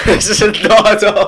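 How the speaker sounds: speaking through loud laughter; laughing. Real, not acted. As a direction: blurt it out mid-laugh. Laughing while protesting.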